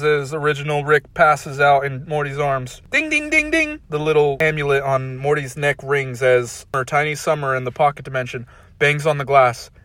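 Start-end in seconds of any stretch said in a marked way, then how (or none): none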